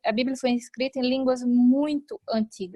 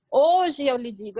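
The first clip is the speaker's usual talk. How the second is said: "Hoje" hit louder, joined to "eu lhe digo"; emphatic "Hoje"; 5 dB louder than usual. Shown as a demonstration. Showing the intonation Jesus uses, stress on "Hoje". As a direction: lean on "Hoje" as part of the declaration. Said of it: "o hoje como uma entonação".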